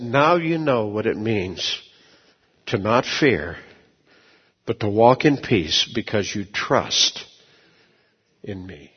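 a lightly voiced, gentle Lord; voice of God. Retells God speaking to him.

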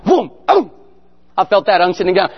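usual speaking voice

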